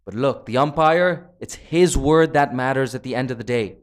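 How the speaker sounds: loud, high-pitched live cricket commentary; excited play-by-play. Real, not acted. Commentator tells viewers of two wickets in two balls, excited play-by-play.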